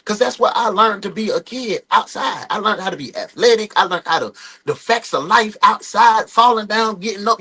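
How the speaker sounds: ordinary talk, nothing marked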